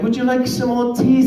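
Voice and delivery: put-on high, prim tea-party voice of a small girl; dainty and high, offering tea; posh little girl. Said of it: "my daughter's like".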